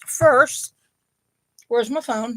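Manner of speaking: usual speaking voice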